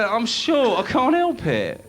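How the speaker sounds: high, slow self-pitying whine; whining short person. Retold or imitated. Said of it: "they always come out the same thing".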